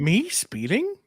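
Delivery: soft, high, incredulous question; playing stupid. Shown as a demonstration. Friend posing as a driver caught speeding, playing stupid. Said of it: "play stupid?".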